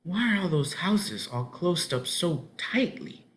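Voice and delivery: put-on high, slow, curious child's question; girl's voice. A narrator's reading. Ellen asking Mister Murdoch about the houses, high child voice. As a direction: peer round and ask timidly.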